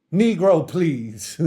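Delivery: loud, high scoffing outburst; big exaggerated brush-off; mock dismissal. Mock dismissal, then repeated low.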